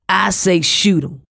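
slow, gruff growl of a brute; a narrated growl, only lightly done; mild growl. A narrator's reading. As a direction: drag out the words like a growl. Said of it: "growled the man".

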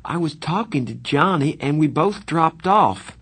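pleading. A narrator's reading. Pony explaining his lateness to Darry, pleading. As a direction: explain yourself, begging to be believed. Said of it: "I pleaded with Darry".

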